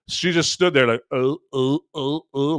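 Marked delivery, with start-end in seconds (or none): 1.1-2.6 imitating a tongue-tied, stunned stammer. Mimicking the pit crew member frozen after scolding, stunned stammer. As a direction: stammer like you just swallowed a bug. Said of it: "like she had just swallowed a bug".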